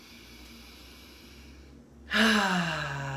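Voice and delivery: long, voiced "ahh" on the out-breath; cleansing sigh. Shown as a demonstration. Leading listeners in cleansing breaths, long audible sigh. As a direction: exhale audibly, letting it fall away. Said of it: "like I'm doing, feel free to make noise".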